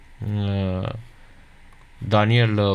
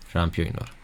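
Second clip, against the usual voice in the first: quiet mutter; 5 semitones lower, 7 dB quieter than usual. Pretend. Muttered aside to chat.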